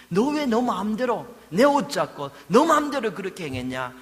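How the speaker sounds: hypothetical angry rebuke; harsh scolding. Shown as a demonstration. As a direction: bark the accusation she doesn't deserve. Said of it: "이렇게 강하게 말씀하시지 않습니다".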